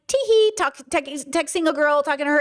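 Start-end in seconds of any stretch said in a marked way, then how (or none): 0.1-0.5 high-pitched, put-on tee-hee; mock coy giggle. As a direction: imitate a coy little titter. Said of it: "be like".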